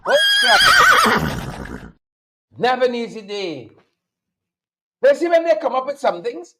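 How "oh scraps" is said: very high, sugary put-on voice; sweet candy voice. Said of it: "In my sweet candy voice".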